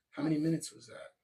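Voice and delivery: ordinary talk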